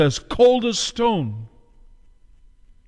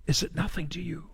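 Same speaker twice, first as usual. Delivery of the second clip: much softer, nearly breathed echo of the question; hushed repeat; 10 dB quieter than usual. Acted. Preacher repeating the widow's question to the congregation, much softer. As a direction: let it fall to a hushed, aching echo.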